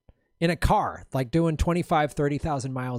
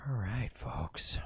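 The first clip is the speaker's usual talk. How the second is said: whisper; 4 semitones lower, 11 dB quieter, much slower than usual. Real, not acted. Whispered aside to chat.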